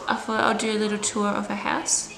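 ordinary delivery

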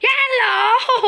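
high cartoon squeak, whole line up high; chipmunk-high mocking voice; squeaky character voice. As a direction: play a tiny squeaky creature.

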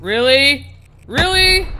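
loud, high rising question; exasperated and outraged; angry disbelief. Real, not acted. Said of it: "I was raging a little bit there".

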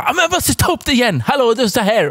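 squeaky exasperated complaint; high whiny mock girlfriend. Girlfriend complaining high to her boyfriend on the way out. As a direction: pitch it up, whiny and fed up.

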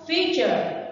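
FEAture, as a model word; stress on first syllable. Shown as a demonstration. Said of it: "the stress is on the first one".

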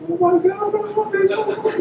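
very high cooing voice for a puppy. Pretend. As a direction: coo it like talking to a puppy. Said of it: "It's my puppy voice".

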